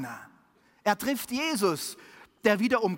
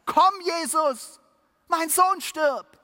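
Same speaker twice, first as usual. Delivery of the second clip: slow, much louder and higher desperate cry; desperate call; 9 semitones higher, 7 dB louder, slower than usual. A narrator's reading. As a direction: call out slowly, pleading with all your strength. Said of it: "ruft er Jesus verzweifelt an".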